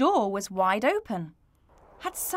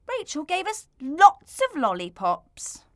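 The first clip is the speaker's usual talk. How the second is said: higher, louder sulky complaint; sulky child; 7 semitones higher, 6 dB louder than usual. Acted. Little vampire sulking at Mister Sniffer. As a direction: pout and complain. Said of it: "made a face".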